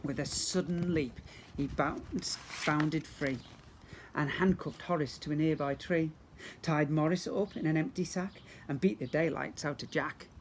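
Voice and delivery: usual speaking voice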